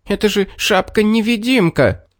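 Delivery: high, exasperated cry; fox yelling. A narrator's reading. Fox scolds the clueless bear, high mock yell. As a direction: wail it high without extra volume. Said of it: "завопила лиса".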